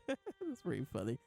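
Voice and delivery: repeating it through a laugh. Real, not acted. Laughing again at the manga joke.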